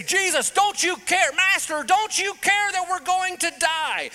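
high, loud, desperate cry; panicked plea. Retold or imitated. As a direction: yell over the storm in panic. Disciples panicking in storm.

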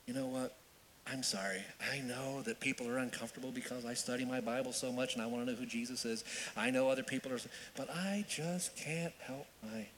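soft, low, mildly apologetic; apologetic confession. Acted. Believer apologising for studying the Bible, softly apologetic. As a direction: confess it quietly, slowing on the last words. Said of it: "has that ever been translated".

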